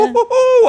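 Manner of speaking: very high, loud woo-hoo laugh; pained laughing whoop. Real, not acted. Cry of pain from the pineapple digging into arm, laughing whoop.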